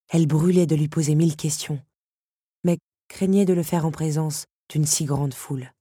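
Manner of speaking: ordinary delivery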